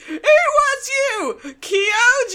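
high, slow, crying shout; mock anguished cry. Domon discovering his brother, crying mock wail. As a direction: wail the name in hammed-up grief.

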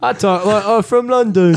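high mocking London accent; bad London accent. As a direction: send up a Russell Brand London voice. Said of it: "just a shitty Russell Brand".

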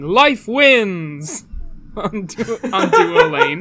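loud, drawn-out battle cry; hammed-up tree creature proclamation; Maokai voice. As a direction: boom it out like a game character. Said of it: "Now we're gonna start saying".

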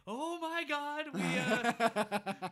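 high, drawn-out panicked cry, very loud; struggling to keep it together. Retold or imitated. Boy saying grace while hiding the hand, barely keeping it together. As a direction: stall loudly while barely holding it together. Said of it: "he's trying to keep it together".

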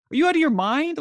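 exasperated, pitch shooting up high and quick; are you kidding me, pitched high; incredulous. Real, not acted. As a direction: disbelief bursting out at the absurdity. Incredulous rant on drug policy.

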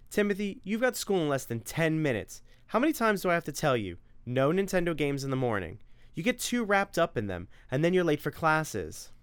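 a mother scolding her son about morning video games; nagging mother. Acted. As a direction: lay down the house rules. Narrator voices the mother.

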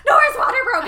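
very high, loud panicked yell; mock panicked scream. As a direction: scream it like a terrified dad. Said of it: "just starts screaming".

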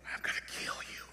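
hushed, mock-creepy whisper; menacing whisper. Preacher plays the voice heard in the house, creepy whisper. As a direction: whisper it like a horror-movie killer.

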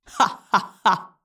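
mocking alien laugh. A narrator's reading. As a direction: laugh at his naivety. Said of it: "the Yeerk laughed in my head".

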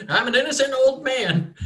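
put-on high cracked old farmer pleading innocence. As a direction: beg like a frightened old farmer.

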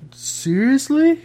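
high, slow, incredulous complaint; mock outrage at missing tears; exasperated disbelief. Friend whining to friends about a TV death, high and incredulous. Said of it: "and you're just like".

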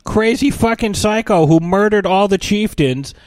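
put-on high-pitched, naive whiny teen; mock a squeaky kid cheerfully reasoning; Hiccup. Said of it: "the next movie is like".

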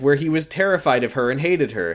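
ordinary talk

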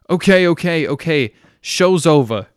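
loud call from a balcony. Acted. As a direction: shout it down from above. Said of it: "calling from the balcony".

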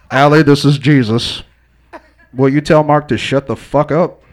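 a joking deep-ish voice from above; Jesus voice. Pretend. Joking as Jesus to the host, a mock divine voice. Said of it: "why Jesus talks like me".

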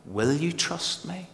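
ordinary delivery

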